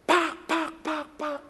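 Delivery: high mock slapping sounds; playful slap noises; imitated slaps. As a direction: smack smack as spoken noise.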